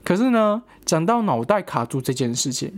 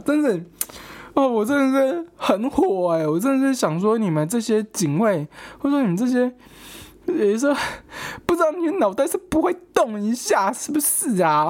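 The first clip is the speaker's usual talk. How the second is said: worked-up rant; 7 semitones higher than usual. Real, not acted.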